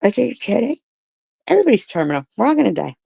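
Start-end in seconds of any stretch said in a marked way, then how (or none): none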